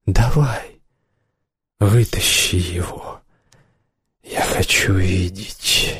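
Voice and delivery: low, slow and hushed come-on; brazen coaxing. A narrator's reading. Woman coaxes skinhead to show his knife, brazen and hushed. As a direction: draw it out softly, teasing. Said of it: "сказала она развязно".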